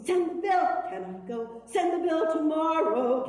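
high, loud, half-sung shout; shouted demand. Acted. Patient shouting at the doctor, high and loud. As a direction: demand the bill and get out.

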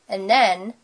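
ordinary delivery

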